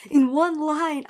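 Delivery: loud, high astonished repeat; emphatic amazement. Real, not acted. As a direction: marvel at it out loud.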